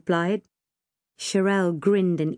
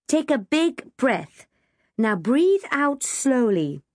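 slow, raised-pitch breathing instructions; slow coaching; 6 semitones higher, slower than usual. A narrator's reading. Girl guiding her friend through panic, coaching slowly. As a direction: draw out each instruction.

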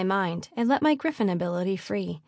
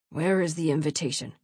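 lower, demanding baron's voice; stern father; 4 semitones lower than usual. A narrator's reading. Posing as father, demanding invitation from daughter, stern.

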